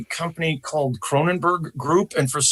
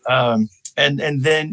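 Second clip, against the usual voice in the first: ordinary talk, nothing marked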